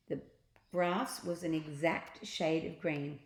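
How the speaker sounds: ordinary delivery, nothing marked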